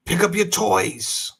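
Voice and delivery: stern parent order, slow; firm parent command. Retold or imitated. Father telling daughter to pick up toys, firm and slow. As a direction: tell the kid off firmly. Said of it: "we telling her now".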